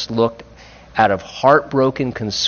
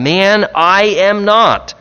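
emphatic denial; 7 semitones higher, 4 dB louder, slower than usual. Retold or imitated. Peter's second denial.

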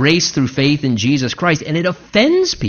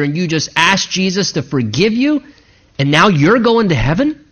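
normal talking voice

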